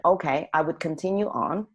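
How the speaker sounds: normal talking voice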